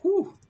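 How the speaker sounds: high loud whoop of effort; excited whoop. Real, not acted. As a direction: let out a battle whoop. Battle cry during fight.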